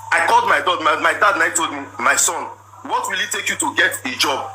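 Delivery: normal talking voice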